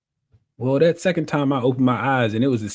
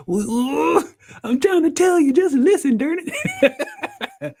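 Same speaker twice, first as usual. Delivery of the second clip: high, frustrated, half-laughing outburst, much higher; upset; much slower than usual. Retold or imitated. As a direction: plead in frustration to be understood. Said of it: "so I get upset".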